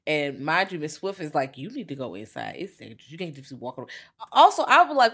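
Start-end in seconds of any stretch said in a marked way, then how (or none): 1.6-3.9 low, very soft caution; hushed acted warning. Miss Swift warning her to go inside, hushed. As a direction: voice a warning under your breath.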